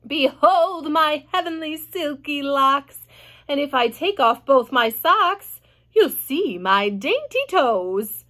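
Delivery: lightly voiced vain aunt, slow and showy; a touch of a boastful aunt; Aunt Sponge. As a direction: preen like a vain old aunt reciting.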